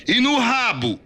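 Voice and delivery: very high, loud sergeant's jeer; shouted taunt. A narrator's reading. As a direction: yell it for the whole room to hear.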